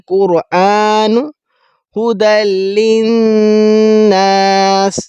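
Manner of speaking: slow melodic Quran chanting; Quran recitation. Acted. (قرآن کی تلاوت، آہستہ اور لحن کے ساتھ)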